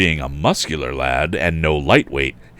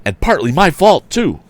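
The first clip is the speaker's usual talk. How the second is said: high raised voice, alarmed; 10 semitones higher, 4 dB louder than usual. A narrator's reading. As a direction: keep the alarm as you call it. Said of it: "cried Tom".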